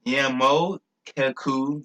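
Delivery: "Yamokuku" very slow, broken into syllables; sounding it out. Shown as a demonstration. Speaker works out his own word "Yamokuku", slowly syllable by syllable. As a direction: draw out "Yamokuku" piece by piece.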